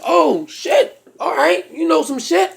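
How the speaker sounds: high, rising "oh shit"; high-pitched amazed praise; impressed surprise. Retold or imitated. Impressed by answers.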